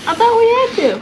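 insistent. Real, not acted. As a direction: press the point, defensive. Repeats claim, insisting.

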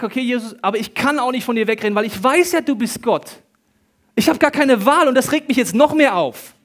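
loud, high-pitched exasperated protest; retold angry outburst. Patient re-enacting his protest to Jesus, angry outburst.

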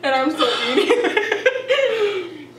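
laughing. Real, not acted. Laughing while eating.

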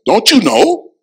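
lightly voiced apostle Paul; voicing Paul. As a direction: ask it like Paul, incredulous. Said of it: "I love the way he starts it off".

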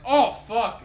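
half-mock gross-out cry; disgusted outburst. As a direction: retell the shock of smelling the gym shirt. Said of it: "I'm like".

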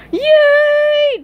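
very high, loud drawn-out cheer. Acted. Patrick cheers SpongeBob's walkie-talkie idea, cheering. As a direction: whoop it out in glee. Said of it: "cheered Patrick".

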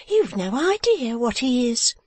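high, slow, confiding lady's voice; fussy wife. A narrator's reading. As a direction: draw it out, half admiring. Wife fusses about uncle.

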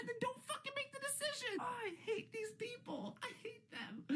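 high shriek of frustration breaking into laughter; shrieking rant. Real, not acted. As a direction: squeal it out, laughing at how fed up you are. Ranting about Josh crying.

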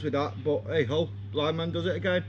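talking around a hookah mouthpiece; words muffled by a mouthpiece; speaking with mouthpiece at lips. Real, not acted. Seen 0: Mouthpiece reaches lips only near the end of the words.

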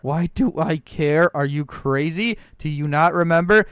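normal talking voice

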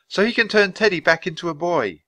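put-on high-pitched girl's voice; high, pleading little girl; urging girl. A narrator's reading. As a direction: explain it earnestly to the bird. Annie urges the bird.

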